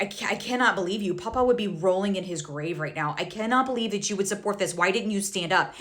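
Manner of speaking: ordinary talk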